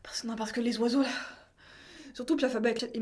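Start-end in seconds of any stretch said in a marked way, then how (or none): 0.0-1.1 whisper. Real, not acted. Voice strained, whispering.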